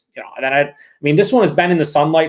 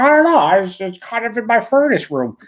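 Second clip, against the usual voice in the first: mock clueless excuse, pitched much higher and louder; mock clueless Sith; 7 semitones higher, 3 dB louder than usual. Streamer mocking a viewer's friend, in a high clueless voice. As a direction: play the dim friend who aged beer by the furnace.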